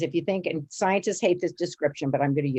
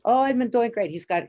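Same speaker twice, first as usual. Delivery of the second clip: lightly imitated Chinese accent, pitched up; bad Chinese accent; 6 semitones higher than usual. Retelling a gynecologist's news about her brother, imitated Chinese accent. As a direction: put on a rough Chinese accent. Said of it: "pardon my bad Chinese accent".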